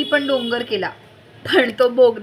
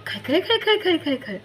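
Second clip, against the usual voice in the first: playful splashing wave noises; child imitating waves; wave sounds; 6 semitones higher than usual. A narrator's reading. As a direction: make the sea gurgle and splash.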